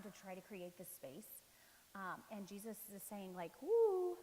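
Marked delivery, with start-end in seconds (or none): 3.6-4.2 high, loud whoop to halt someone; sudden mock "woo", pitched way up; acted "woo". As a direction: whoop it out to stop the rush. Voicing Jesus calling a halt.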